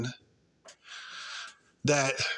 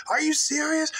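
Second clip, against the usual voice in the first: mock outraged woman, repeated; 14 semitones higher than usual.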